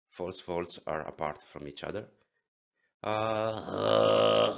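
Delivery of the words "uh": loud squeezed throat rasp; He Mei voice. Shown as a demonstration. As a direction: push a rough throat growl. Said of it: "that was the He Mei voice".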